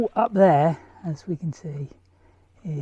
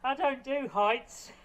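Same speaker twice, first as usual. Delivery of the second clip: winded after the climb; breathless, catching breath; 5 semitones higher than usual. Real, not acted. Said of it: "I'm a little bit out of breath".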